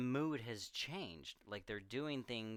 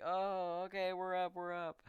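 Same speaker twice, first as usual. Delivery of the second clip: high-pitched mock protest from sleepers; startled-awake crowd; 10 semitones higher, 4 dB louder than usual. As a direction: imitate kids roused by a blaring mic. Imitating crowd jolted awake by microphone.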